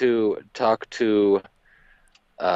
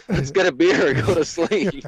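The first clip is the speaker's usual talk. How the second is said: laughing; 6 semitones higher than usual. Retold or imitated. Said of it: "And I'm like".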